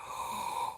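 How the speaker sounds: sharp breathless gasp; mock shocked gasp. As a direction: gasp as if scandalised. Shocked by remembered slight.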